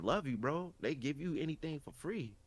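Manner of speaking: ordinary talk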